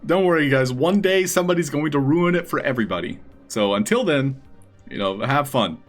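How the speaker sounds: ordinary delivery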